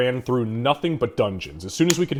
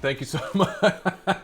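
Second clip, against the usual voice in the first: laughing through the thanks; thanks broken by a laugh; 7 semitones higher, slower than usual. Real, not acted.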